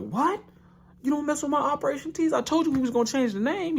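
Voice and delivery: hammed-up offended coach; outraged coach voice. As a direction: coach offended at Ippo's refusal, high squeaky rant. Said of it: "Coach is like".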